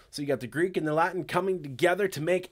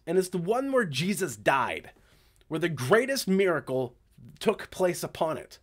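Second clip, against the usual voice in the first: ordinary delivery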